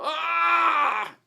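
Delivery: high, loud pained "ah!"; loud flinching shout; loud annoyed yell. Retold or imitated. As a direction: yell as if jabbed hard. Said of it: "you gotta go".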